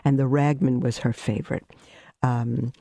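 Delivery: usual speaking voice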